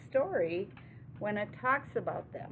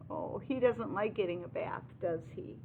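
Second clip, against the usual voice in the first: plain reading voice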